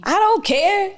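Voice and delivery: high, loud retort. Real, not acted. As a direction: blurt it out loud. Reacting loudly to whispering.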